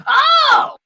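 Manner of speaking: very high, loud character exclamation; sudden realization. Acted. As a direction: squeal it as it dawns on you. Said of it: "he goes".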